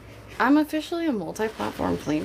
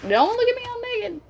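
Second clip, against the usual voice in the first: much higher, squeaky "Don't look at me all naked"; mock squeal. Shown as a demonstration. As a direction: squeal it like you're caught bare. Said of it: "Like that kind of energy".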